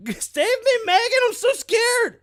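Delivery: very high, loud falsetto whimper; hammed-up frightened falsetto begging Megan; mock whiny plea. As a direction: squeal like a scared child begging for rescue. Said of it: "He's like".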